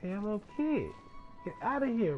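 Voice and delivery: ordinary talk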